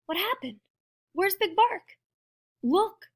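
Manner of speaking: high-pitched startled questions; startled wondering. Acted. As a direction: puzzle in a small high voice. Startled prairie dogs wonder.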